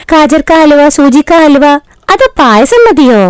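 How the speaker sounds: high, eager bride's voice; new bride sweetly asking what to cook; sweet young bride. Acted. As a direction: lay on the sweetness for the mother-in-law. Rani answering mother-in-law.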